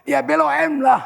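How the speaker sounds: high, loud comic comeback; loud retort. Retold or imitated. Batak conductor answers the driver, shouting back. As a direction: shout back as if it were obvious.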